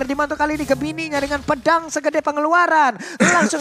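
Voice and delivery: lively play-by-play calling; esports caster. Acted. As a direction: call the teamfight live. Live play-by-play.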